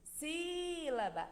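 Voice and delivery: Sílaba with SÍ drawn out high; long first syllable on Sílaba, falling after; calling it over. Shown as a demonstration. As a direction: call Sílaba as if it were far away. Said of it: "eu tivesse que chamar ela".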